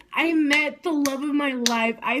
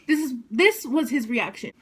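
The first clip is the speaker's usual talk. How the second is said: usual speaking voice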